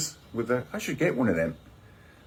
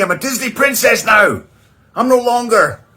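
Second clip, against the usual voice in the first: much higher and louder, grand mock announcement; princess proclamation; 10 semitones higher, 8 dB louder than usual. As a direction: declare the new self with fanfare. Returns to princess persona.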